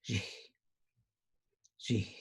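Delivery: "ji" said twice with breathy vowel; Zapotec word. Shown as a demonstration. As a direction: say "ji" breathy, then pause. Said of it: "in Zapotec is ji".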